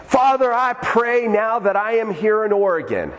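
mock-pious prayer, pitched high; high, grandly intoned address to God; super-spiritual friend. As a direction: imitate a showy, solemn prayer. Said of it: "he acts like he's super spiritual".

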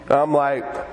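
ordinary talk

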